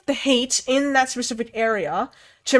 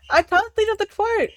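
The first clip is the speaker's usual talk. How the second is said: higher, whiny repeat; mock whine; 9 semitones higher than usual. Real, not acted. Forgetting the movie quote, higher mock whine.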